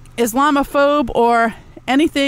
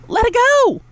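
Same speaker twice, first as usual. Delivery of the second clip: much higher, exasperated repeat; pitched-up repeat; 11 semitones higher than usual. Real, not acted. Ranting at viewers, repeats the advice much higher. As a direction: fling it up high in exasperation.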